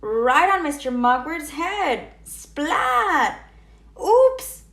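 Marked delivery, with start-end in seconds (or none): 4.0-4.6 much higher, louder oops; startled oops. A narrator's reading. Froggy drops eggs on the director, a loud high oops. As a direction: squeak out a guilty oops. Froggy after egg accident.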